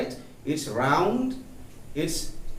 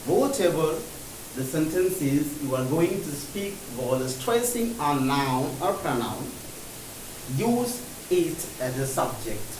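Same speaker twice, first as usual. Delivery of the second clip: slow recap; much slower than usual. Shown as a demonstration. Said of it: "teacher slow recap".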